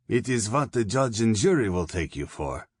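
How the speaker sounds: a sly German officer warning the prisoner; Fritsch, German colonel. A narrator's reading.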